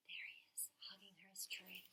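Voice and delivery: soft breathy whisper to a little listener. Real, not acted. Reading to Georgia, pointing at the boy, whispered. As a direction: whisper it like a secret over the picture book.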